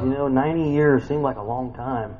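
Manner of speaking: normal talking voice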